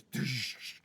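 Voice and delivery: mouthed hissing burst of a panel releasing; vocal sound effect, hissy release; imitated release noise. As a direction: make a short whooshing release effect. Game master imitates panel releasing.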